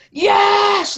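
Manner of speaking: high, stretched re-enacted yell; mock scream in someone's face. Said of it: "I'm screaming at him. I'm shouting right in his face".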